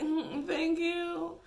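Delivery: high, slow, reluctant thanks; awkward thanks. Retold or imitated. To the man calling her beautiful, a slow awkward thank you. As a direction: accept the compliment without meaning it. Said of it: "I'm like".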